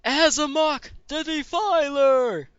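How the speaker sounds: loud, high, slow fighter introduction; arena announcer. Acted. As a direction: bellow the name to the whole arena.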